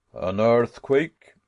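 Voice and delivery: slow, cool, teasing question; unruffled and needling; calm provoking tone. Acted. Professor coolly questioning his panicked nephew, slow and provoking. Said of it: "in the most calm and provoking tone".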